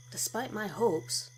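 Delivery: ordinary delivery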